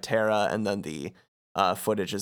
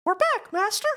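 squeaky falsetto cartoon mouse; Mickey. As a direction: chirp it in a very high mouse voice. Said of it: "we hear Mickey say".

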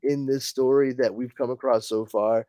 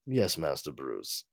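low, quiet, proper butler; hushed English butler voice; dry butler reply; 11 semitones lower, 12 dB quieter than usual. Acted. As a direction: answer like a dutiful old butler. Alfred answers Bruce.

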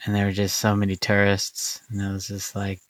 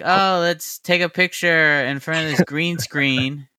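mocking tour guide voice; 7 semitones higher than usual. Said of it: "they're like".

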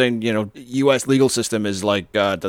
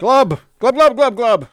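loud high gurgling glubs; gurgling fish man croak; 13 semitones higher, 9 dB louder, slower than usual. Pretend. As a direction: gurgle like a man going under. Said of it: "That's the sound ... me treading water".

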